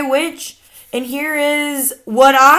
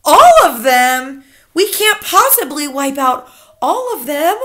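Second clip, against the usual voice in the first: appalled; 3 semitones higher, 6 dB louder than usual. A narrator's reading. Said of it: "saying aloud".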